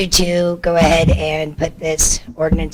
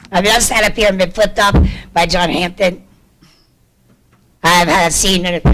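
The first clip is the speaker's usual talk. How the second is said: usual speaking voice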